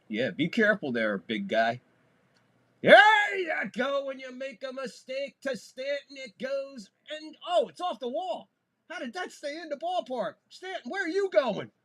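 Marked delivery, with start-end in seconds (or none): 2.8-11.7 higher, fast-running home run call, shouted at the start; breathless broadcaster call for a big hit; excited play-by-play. Real, not acted. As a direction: ride the thrill of the double.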